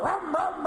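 high revving engine noise; motorgeluid nadoen. Retold or imitated. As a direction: rev it like a car engine. Imitating a car engine.

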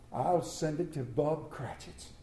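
soft and slow, a private scheme; sly murmur. Acted. Scrooge plotting his gift to himself, soft and slow. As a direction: keep it secret, softly.

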